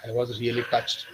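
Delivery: ordinary delivery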